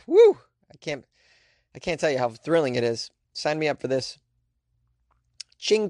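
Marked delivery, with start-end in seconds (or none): 0.1-0.4 mock cheer. Mock excitement about airport tour.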